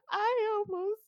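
high, slow mock sobbing; blubbering. Dreamer tells her friend about the wolf, blubbering. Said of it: "blubbered to him".

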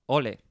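"Ole" stressed on the O; stress on first syllable. Shown as a demonstration. Testing a one-syllable claim, "Ole" with stress on the O. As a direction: say "Ole" as Ó-le, accent up front. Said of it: "ole con acento en la O".

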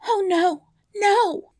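very high, loud cry; high, frantic storybook protest; distressed princess. A narrator's reading. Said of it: "she cried".

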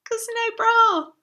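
high, loud coy squeal; coy squeak. Pretend. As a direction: peek round the door and squeal it. Coy head round door.

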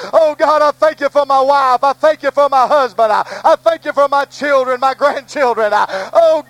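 praying aloud to God before the church; earnest spoken prayer, pleading; preacher leading a prayer; fervent prayer. Real, not acted. Leads fervent congregational prayer.

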